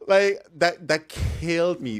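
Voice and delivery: loud, high words through fading laughter; still laughing. Real, not acted. Vlogger recovering from laughter about the story, still giggling.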